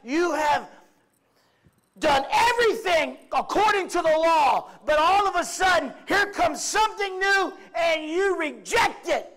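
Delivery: high, loud, accusing shout; preacher voicing Stephen's angry rebuke; laying into them. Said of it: "he lays into them and is like".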